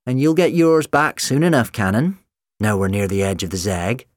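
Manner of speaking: robot woman voice. A narrator's reading. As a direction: taunt like a villainous machine. Robot Sally speaks.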